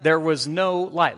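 ordinary delivery

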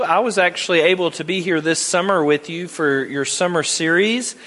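ordinary delivery

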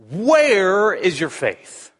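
slow line with "Where" stretched out; stress on WHERE. Shown as a demonstration. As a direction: lean long and hard on "Where", then slide down through "is your faith". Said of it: "put the emphasis on the right syllable".

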